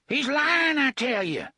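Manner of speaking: high, loud protest; the thug denies it to his boss; panicked cry. Acted. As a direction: cry it out, cornered. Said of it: "Gasher cried".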